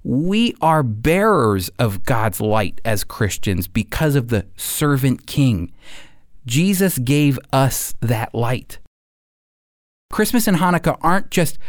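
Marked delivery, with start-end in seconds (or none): none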